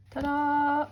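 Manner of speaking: high, loud ta-da fanfare; excited reveal. Real, not acted. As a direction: present it like a magic trick. Unveiling the new artwork.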